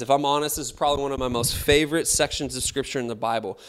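ordinary talk

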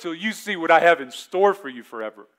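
loud ominous warning; menacing promise. Acted. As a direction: threaten it with big volume. Menacing promise opening sermon.

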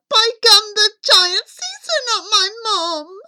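put-on high, loud wailing boy; boy crying out. A narrator's reading. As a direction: wail the shocking news as young Jack. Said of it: "Jack cried".

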